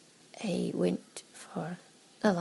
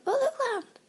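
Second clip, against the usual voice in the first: much higher, delighted cry at seeing land; mock excited spotting; 5 dB louder than usual. Imitating mum at the plane window, excitedly. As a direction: squeal like someone spotting land at last.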